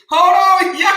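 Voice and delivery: loud, high hold on; shouted. Real, not acted. Host shouting to the audience mid-laugh. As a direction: yell it over your own laughter.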